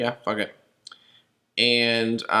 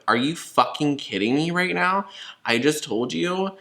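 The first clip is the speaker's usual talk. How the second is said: higher-pitched, incredulous retort; retold exasperation, pitched up; exasperated disbelief; 6 semitones higher than usual. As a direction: imitate your own indignant comeback. Retelling exasperated reply to police officer.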